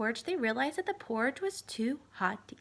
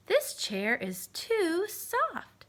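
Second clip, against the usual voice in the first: very slow, drawn-out complaint; Goldilocks; 4 semitones higher, much slower than usual. Acted. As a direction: stretch it way out. Goldilocks tests chairs.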